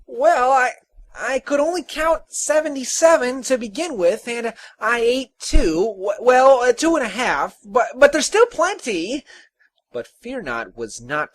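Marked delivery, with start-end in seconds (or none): 0.1-9.2 high, loud, flustered little man; high-pitched, hesitant excuse-making; guilty stammer. Acted. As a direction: stumble through a guilty confession. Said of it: "The tinker frowned guiltily".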